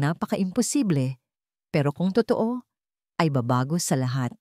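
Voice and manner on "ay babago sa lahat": ordinary delivery, nothing marked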